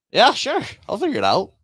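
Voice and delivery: high, loud retold eager yes; eager five-year-old self. Volunteer answering the man with the mic, high eager acceptance. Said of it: "my five-year-old self was like".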